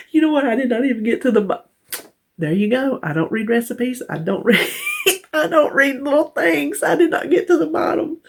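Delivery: high, giggly self-mocking disbelief; real laughter pushing the voice up high; laughing at herself. Real, not acted. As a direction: crack up as you catch your own mistake. Cracks up at not reading on.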